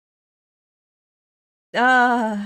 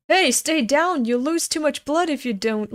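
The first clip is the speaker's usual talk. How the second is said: a sudden higher, louder command; urgent order; 5 semitones higher, 3 dB louder than usual. Acted. Soldier ordering a wounded man to stay down, urgent and loud. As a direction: snap him back down onto the ground.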